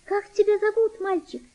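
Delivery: put-on girl's voice. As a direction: ask the boy's name like a kind girl. Girl asks the boy's name.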